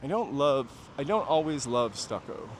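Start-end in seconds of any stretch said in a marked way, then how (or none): none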